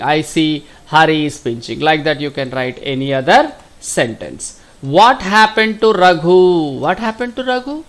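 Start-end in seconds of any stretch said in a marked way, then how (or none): 4.8-6.8 slow, drawn-out reading of the question. Shown as a demonstration. Teacher reads the worksheet question to pupils, slow and drawn out. 6.8-7.8 quick, high and rising; quick prompting question. Shown as a demonstration. Teacher prompts pupils for the answer, quick rising question.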